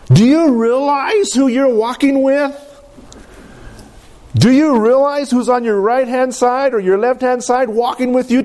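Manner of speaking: high, impassioned preacher's voice; preaching at full force. Acted. Preacher urges his congregation to trust, impassioned. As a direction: press it on the congregation with fervour.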